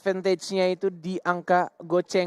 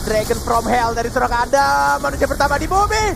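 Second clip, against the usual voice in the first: rising hype play-by-play, louder; esports caster; 7 semitones higher, 6 dB louder than usual. Acted. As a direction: call the teamfight live, building up. Live play-by-play call.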